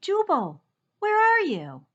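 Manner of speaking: high, loud call across the storm. Acted. Faint voice calling for Jubal through the storm, high and loud. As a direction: call his name over the rain. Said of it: "A faint voice called in the distance".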